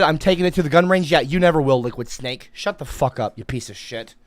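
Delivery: ordinary talk, nothing marked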